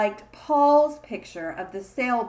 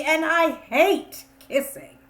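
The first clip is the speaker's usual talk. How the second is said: disgusted whine; 6 semitones higher, 6 dB louder than usual. Acted. Child complains of kissing.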